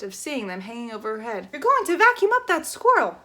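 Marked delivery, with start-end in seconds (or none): 1.5-3.2 much higher, louder alarm; full shout. Acted. Flora seeing the squirrel in danger, full shout. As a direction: scream it out.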